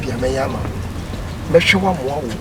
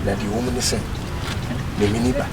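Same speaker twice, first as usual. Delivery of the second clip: softer, lower comforting voice; calm him gently; soft reassurance; 5 semitones lower, 7 dB quieter than usual. Acted. Tender comforting after quarrel.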